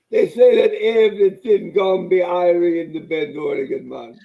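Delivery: loud, laid-back fake Jamaican accent; mock Jamaican accent. Joking Oregon accent is Jamaican, loud mock Jamaican accent. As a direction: sell irie like a hammed-up Jamaican. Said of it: "It's also Jamaican".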